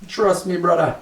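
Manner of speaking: high mock bro voice; hammed-up trust me, brother. Mock-emphatic catchphrase.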